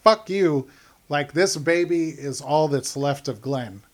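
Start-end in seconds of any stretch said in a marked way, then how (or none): 0.0-0.7 loud, sharp defiant retort; defiant "fuck you". Retold or imitated. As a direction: spit it back in defiance. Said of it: "I think it's mostly just like".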